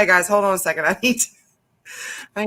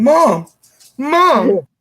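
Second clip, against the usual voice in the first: put-on child calling mom; 7 semitones higher, 7 dB louder than usual. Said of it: "I got Lucy over here going".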